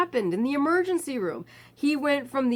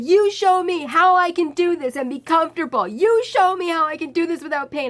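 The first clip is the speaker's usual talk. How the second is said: loud, higher ranting demand; the screamer ranting at the guard; angry yelling; 7 semitones higher, 7 dB louder than usual. Retold or imitated. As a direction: yell it at the guard. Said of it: "And he's like".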